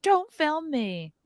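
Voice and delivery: high, drawn-out protest; mock camera objection. Imitating protesters who hate being filmed, mock protest. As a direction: imitate someone fussing at the camera, slow and high. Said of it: "these people being like".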